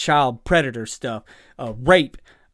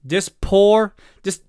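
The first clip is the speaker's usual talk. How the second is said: normal talking voice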